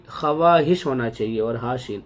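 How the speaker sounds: usual speaking voice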